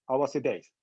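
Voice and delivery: a quick, matter-of-fact reply with no pause before hours; blunt instant answer. Retold or imitated. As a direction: snap back with the answer, no pause. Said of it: "they respond right away".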